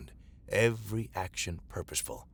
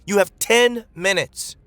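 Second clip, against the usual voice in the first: loud urgent shout, much higher; booming warning; 12 semitones higher, 10 dB louder than usual. Acted. Mara shouting a deadline to the evacuees. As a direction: drive the crowd to hurry. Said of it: "Mara's voice boomed".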